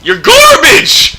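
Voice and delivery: high, loud, cracking yell. Real, not acted. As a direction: let the voice crack on the shout. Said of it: "Voice crack of the century".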